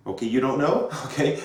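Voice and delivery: ordinary talk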